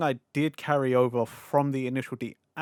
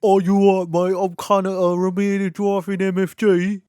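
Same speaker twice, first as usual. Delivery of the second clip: loud, higher, hammed-up Cockney accent; 6 semitones higher, 7 dB louder than usual. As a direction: belt it out as a cheeky Cockney racer. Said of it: "all of a sudden he's Cockney".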